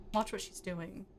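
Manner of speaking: ordinary delivery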